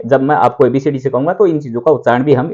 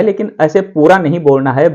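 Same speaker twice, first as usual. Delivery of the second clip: ordinary talk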